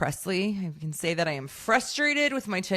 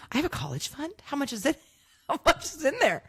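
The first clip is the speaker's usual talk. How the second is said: quoting daughter; 8 semitones higher, faster than usual. Retold or imitated. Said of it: "And then she's like".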